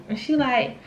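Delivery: ordinary delivery, nothing marked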